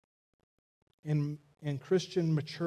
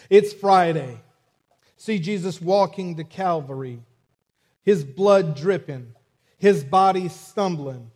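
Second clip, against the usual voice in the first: loud, rousing Southern preacher cadence; imitated Southern preacher delivery, swelling louder; Southern accent; 3 semitones higher, 8 dB louder than usual. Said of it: "do it in my best Southern accent".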